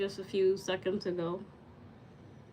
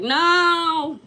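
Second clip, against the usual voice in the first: emphatic shout; 6 dB louder than usual. Retold or imitated. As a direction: shout it down with conviction. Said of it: "I'm just like".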